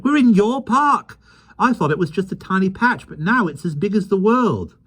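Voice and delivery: put-on high, excited boy voice; a boy stunned by a giant world; amazed boy. Michael amazed in park.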